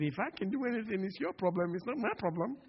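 high-pitched challenge thrown back; acted retort. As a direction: voice Jesus turning the doubt back on the man. Acts Jesus throwing challenge back.

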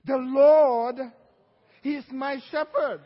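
THE LORD shouted out to the congregation; leading the church in a loud declaration; loud call and response. Shown as a demonstration. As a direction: call it out loud so they repeat. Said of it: "So say it with me".

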